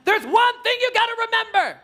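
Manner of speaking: high, projected announcement. Shown as a demonstration. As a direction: call it out high to a whole hall. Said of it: "'Cause if you're like".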